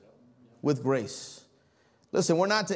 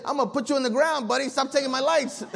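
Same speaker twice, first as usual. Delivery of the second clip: mock threat; 7 semitones higher than usual. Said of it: "my flesh wants to go to their door".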